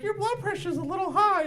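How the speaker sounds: high-pitched mock nurse voice; imitated nurse. Acts out the nurse speaking.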